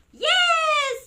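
high, loud, drawn-out cheer; kids shouting. Retold or imitated. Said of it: "kids will shout at the full volume".

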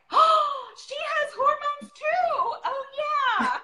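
hammed-up "oh of course" surprise with a laugh; realization. Mother retells her surprised realization, high and laughing. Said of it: "And I'm like".

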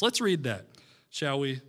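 ordinary delivery

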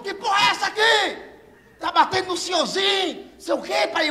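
retold cop yell. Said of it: "Eu vi ele gritando assim".

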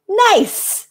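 high-pitched, approving "nice"; proud counselor. A narrator's reading. Counselor looking proudly at his group, high and approving. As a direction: beam with pride on one word. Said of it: "proudly looking at his group".

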